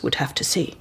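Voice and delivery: a hushed whispered aside. Acted. Colleague muttering aside about the absent doctor, whispered. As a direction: breathe it under the breath.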